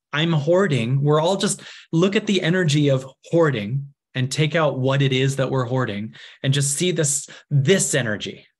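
ordinary talk, nothing marked

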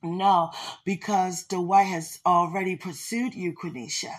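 normal talking voice

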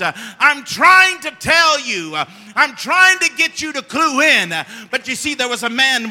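animated storytelling sermon; preaching. Acted. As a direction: act out the scene from the pulpit. Preaching a retold gospel scene.

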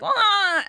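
high mock yell of a kid protesting; child's protest yell. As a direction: squeal it like a kid refusing the shower. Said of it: "And he's like".

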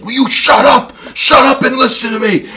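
high-pitched mock yell at the kids; imitated coach yelling. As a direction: holler it like a fed-up coach. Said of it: "He's yelling at these kids".

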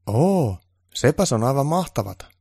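fawning admiration; gushing helper; impressed gasp. Acted. Onni impressed and bowing.

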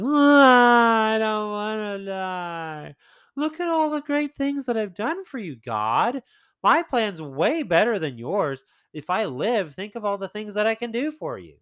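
high-pitched mock whining plea; whiny Hezekiah. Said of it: "kinda whiny".

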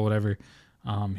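normal talking voice

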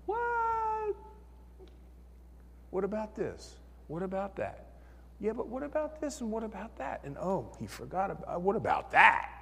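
the mind's doubting voice, quick questioning; a hint of fretful inner questioning; nagging inner doubt. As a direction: pester yourself with objections. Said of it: "my mind's going".

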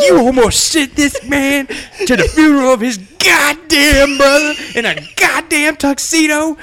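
high, loud mock rage; mock furious yell. Furious boss scolding the clerk, high and loud. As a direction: shriek it out in mock fury.

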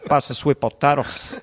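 put-on light Scarface-style Cuban accent; catchphrase in a comic Cuban accent; Tony Montana accent. Tony Montana at Thanksgiving dinner, Cuban accent. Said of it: "in that accent".

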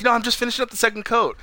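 high, quick, placating voice; Biff all nice. Retold or imitated. Biff answering about waxing the car, put-on meek voice. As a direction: imitate a meek underling covering himself. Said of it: "why is Biff all nice".

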